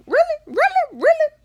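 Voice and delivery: high, squeaky, building "really?"; indignant disbelief. Retold or imitated. Daughter in trouble for another's deed, squeaky indignation.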